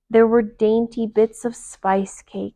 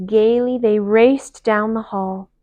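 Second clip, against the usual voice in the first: ordinary delivery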